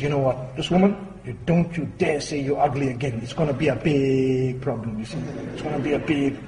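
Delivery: low, hushed mock warning; stern warning. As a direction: warn the bird under your breath. Owner warns the parrot in story.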